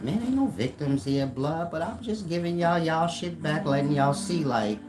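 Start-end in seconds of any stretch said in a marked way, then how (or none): none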